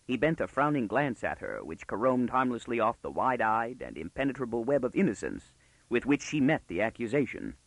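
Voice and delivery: usual speaking voice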